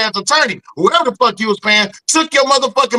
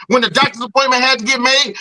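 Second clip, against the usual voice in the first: usual speaking voice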